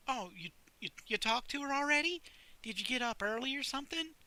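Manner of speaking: surprised he's up early; lightly voiced librarian.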